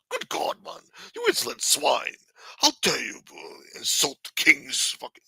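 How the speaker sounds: high, haughty royal outrage; pompous king. Retold or imitated. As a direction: sneer like an offended king. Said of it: "acting like you're fucking King Charles the Third".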